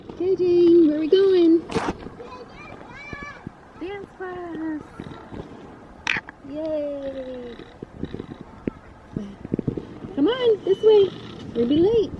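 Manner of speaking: high, sing-song mom voice; talking to a toddler. Acted. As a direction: coax a little kid along brightly.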